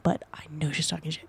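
soft unvoiced whisper. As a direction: whisper it like a secret. Whispered aside about ex's mom.

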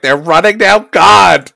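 loud, fed-up complaint; exasperated. Retold or imitated. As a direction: whine it like a tired mom. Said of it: "I'm like".

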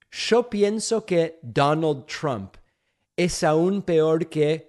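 slow Argentinian Spanish, pienso after a "sho". Shown as a demonstration. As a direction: switch into deliberate Argentinian-accented Spanish. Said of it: "in Spanish".

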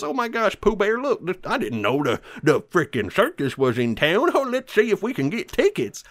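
high, hammed-up farmer thrilled by the circus; giddy farmer. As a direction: gush over the circus tent. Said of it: "or the farmer goes".

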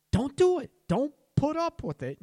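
loud, high, emphatic urging; urgent plea. Real, not acted. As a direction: punch each DON'T high and hard. Urging listeners to refuse mistreatment.

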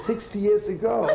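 laughing through the punchline. Real, not acted.

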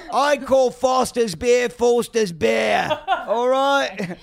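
high, fast mock-Australian accent. Shown as a demonstration. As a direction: call it out in a broad Australian accent. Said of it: "you're Australian".

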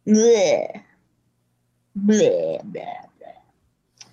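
exaggerated gagging blehs; disgusted bleh. Real, not acted. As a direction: spit out blehs like a bad taste. Reacting to Baszler pick.

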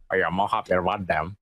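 very low, monotone frog voice; talking frog. Retold or imitated. Co-host as the frog introducing itself, very low deadpan voice. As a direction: drop deep for a deadpan frog. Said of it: "the fucking frog is like".

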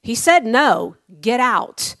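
firm shout of get out; loud command. Retold or imitated. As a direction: throw the salesman out. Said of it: "I'm just like".

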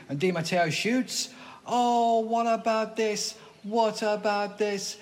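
excited football play-by-play; live commentary. Pretend. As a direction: call the goal like a TV commentator.

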